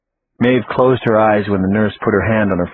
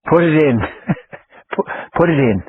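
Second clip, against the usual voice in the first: higher, laughing through the words; laughing fit; 6 semitones higher than usual. A narrator's reading. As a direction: gasp the words out between laughs. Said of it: "Maeve cried through her laughing fit".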